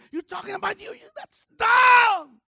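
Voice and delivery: crying out, then dragging out the last words; preaching shout. Real, not acted. Preacher scolding the congregation, slow drawn-out shout. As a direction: shout it at them, slowly.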